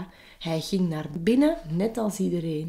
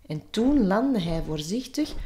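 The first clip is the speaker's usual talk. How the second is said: plain reading voice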